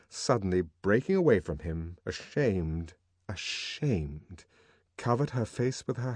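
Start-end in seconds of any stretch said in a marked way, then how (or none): none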